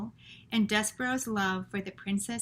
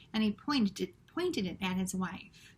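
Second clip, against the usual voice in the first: usual speaking voice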